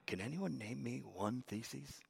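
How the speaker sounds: soft, low pointed question; quiet challenge. Retold or imitated. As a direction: hush the room with a quiet dare. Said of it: "And he says".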